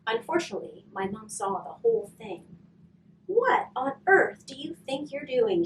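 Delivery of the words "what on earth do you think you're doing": high, sharp yell at her son; mom yelling. A narrator's reading. Mom scolding son caught breaking the window, angry yell. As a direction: scold him for the broken window. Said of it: "she yelled".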